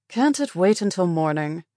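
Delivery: disapproving. Acted. Frowning night nurse to patient wanting a shower, disapproving. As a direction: push back at an unwelcome request.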